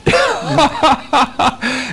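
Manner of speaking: helpless high-pitched laughing fit; real laugh. Real, not acted. Presenter unable to read the reply, laughing hard. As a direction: dissolve into laughter before the punchline. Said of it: "I'm laughing because".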